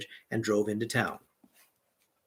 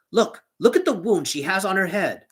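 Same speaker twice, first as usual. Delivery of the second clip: urgent accusation; 7 semitones higher, 5 dB louder than usual. A narrator's reading. Grabbing the innkeeper, accusing.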